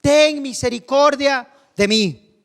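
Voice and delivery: high, drawn-out plea to Jesus; crying out. Acted. Blind man to Jesus over the crowd, crying out. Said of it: "dio voces diciendo".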